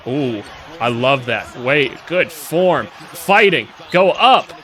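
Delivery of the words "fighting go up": raised-voice cheer, short bursts; urging him on. Real, not acted. Fan urging a player to go up, raised cheer. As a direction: call out to spur the player on.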